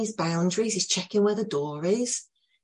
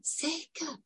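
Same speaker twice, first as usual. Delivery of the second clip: hushed, much higher echo of the word; soft echo; 10 semitones higher, 12 dB quieter than usual. Retold or imitated. The boy echoing the teller, hushed and high. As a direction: echo it back softly like the boy.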